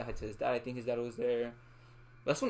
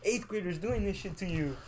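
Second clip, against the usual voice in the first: higher-pitched mock scolding of a friend; imitated disappointment; 6 semitones higher than usual. Friend scolds Edgar over the eighth grader, in mock disappointment. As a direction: imitate someone appalled and let down, pitched up. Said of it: "he was so disappointed".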